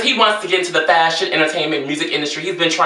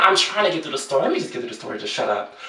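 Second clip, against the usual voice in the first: much softer, fading to a low mutter; hushed aside; 6 semitones lower, 7 dB quieter, much faster than usual. Pretend. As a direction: shush them while getting through the story. Hushing an imagined listener.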